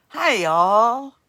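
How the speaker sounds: loud, drawn-out southern hi y'all; American accent try. Shown as a demonstration. Host tries an American accent for viewers, loud twang. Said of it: "Does that sound American?".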